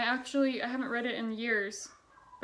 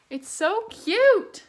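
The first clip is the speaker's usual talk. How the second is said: gushing; 10 semitones higher, 11 dB louder, much slower than usual. Real, not acted. Gushing over the storybook ending.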